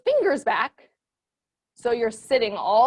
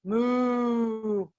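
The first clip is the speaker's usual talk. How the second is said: soft low mooing like a cow; 3 semitones lower, 4 dB quieter than usual. Shown as a demonstration. Teacher back in cow pose for the kids, soft moo.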